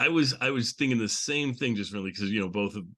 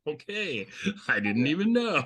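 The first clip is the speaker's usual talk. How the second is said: mock baffled; 6 semitones higher than usual. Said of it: "I'm like".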